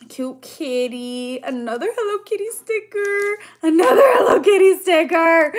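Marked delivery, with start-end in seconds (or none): 3.6-5.5 louder, gushing repeat with a higher voice; excited squeal. Real, not acted. Repeating the sticker name to viewers, loud and overjoyed. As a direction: repeat it louder, bursting with joy.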